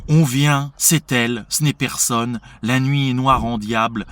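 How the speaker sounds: heated stage monologue; Figaro getting heated. Acted. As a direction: read it out with rising agitation, projecting. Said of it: "il va s'échauffer, Figaro".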